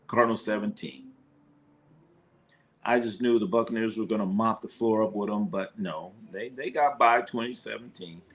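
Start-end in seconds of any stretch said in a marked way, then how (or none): none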